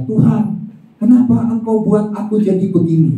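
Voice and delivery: a high, loud mock lament; complaining cry to God. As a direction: act out a whining believer asking why. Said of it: "Kalau saya berseru itu gini".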